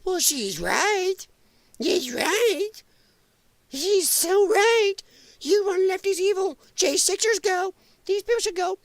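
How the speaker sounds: high, slow sneering lefty parody; high-pitched mock lefty, slowed down. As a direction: ham up a gullible lefty agreeing. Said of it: "the smooth-brained lefties will go with it".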